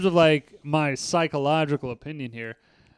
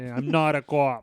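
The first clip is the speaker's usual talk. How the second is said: slow mock Boston accent, "co-op" stretched; Leo's Boston accent, slower; slower than usual. Repeating the actor's line slower, mocking his Boston accent. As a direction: drag out the Boston "co-op" as Leo would. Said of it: "he keys on a couple words like".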